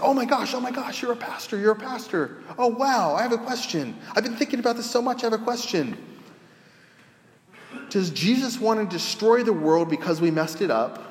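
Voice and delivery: high, eager, tumbling rush of questions; excited stranger. Retold or imitated. Homeless man excitedly asks the pastor his question, high pitched. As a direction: gush like you finally found someone to ask.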